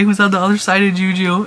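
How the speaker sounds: ordinary talk, nothing marked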